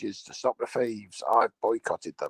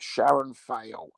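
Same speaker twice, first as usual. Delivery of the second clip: ordinary talk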